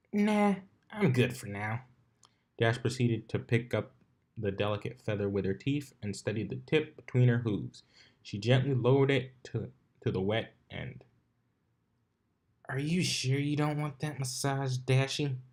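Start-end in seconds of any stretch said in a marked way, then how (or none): 0.1-1.8 higher, breezy pony voice; smug Dash voice. Acted. 12.7-15.4 a lightly voiced sly, teasing inner voice. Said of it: "her more lewd half".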